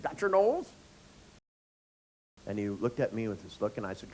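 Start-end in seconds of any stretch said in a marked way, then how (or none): 0.0-0.7 high, louder playful singsong; adult playing got your nose with a kid; teasing a toddler. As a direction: tease a small child. Same singsong quoted to client.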